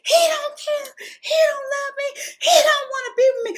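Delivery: high, loud, half-sobbing wail; crying outburst. Retold or imitated. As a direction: sob it out heartbroken. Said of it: "I said".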